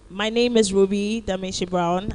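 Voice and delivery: ordinary talk, nothing marked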